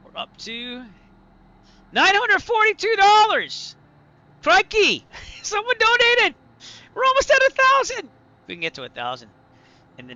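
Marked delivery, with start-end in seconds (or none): 1.9-3.8 high, loud, drawn-out announcement of the total; thrilled shout. Real, not acted. Streamer thrilled at a new donation, loud and high. 4.4-8.1 high, loud squealing cheer; overjoyed. Real, not acted. Streamer cheering viewers' donation, high and loud.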